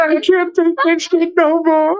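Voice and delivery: high, slow comic wail; exasperated outburst. Real, not acted. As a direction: squeal it in helpless exasperation. Exasperated, cracking up at her.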